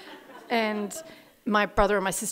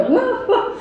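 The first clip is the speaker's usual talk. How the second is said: high, laughing whoa whoa; startled mum; 14 semitones higher, 7 dB louder than usual. Retold or imitated. Mum cutting off the threatening tape, high and flustered. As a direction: stop the tape in a flustered rush. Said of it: "My mum would go".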